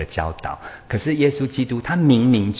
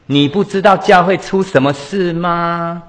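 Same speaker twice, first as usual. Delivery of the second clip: indignant retort; 8 semitones higher, 6 dB louder than usual. Retold or imitated. Brother retorting to invitation.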